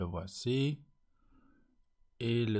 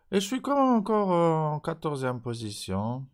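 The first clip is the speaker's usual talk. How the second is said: much higher and louder, fast; a burst of proud glee; excited; 9 semitones higher, 6 dB louder than usual. Real, not acted. Proud joke about sales ranking.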